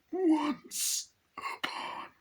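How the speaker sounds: high, very slow, breathy whisper of a sinister double; creepy whisper. Acted. The doppelganger opening her tale, in a high, very slow, creepy whisper. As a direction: draw each word out like a ghost child. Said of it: "even the way they talk is really creepy".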